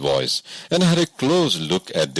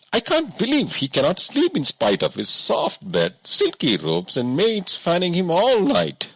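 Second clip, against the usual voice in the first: higher, doubting woodcutter; a simple woodsman who can't believe it; 10 semitones higher than usual. A narrator's reading. Woodcutter gossips about king.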